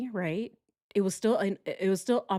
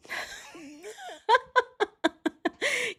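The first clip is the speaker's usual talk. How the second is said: a sudden high-pitched laugh that breaks into a shriek. Real, not acted. Laughing at her own joke, high shrieking laugh. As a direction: let the laugh fly up high.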